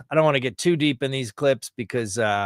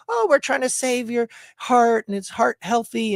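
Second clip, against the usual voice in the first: mock earnest do-gooder; 12 semitones higher, 4 dB louder than usual. Mocks convinced margarine promoters.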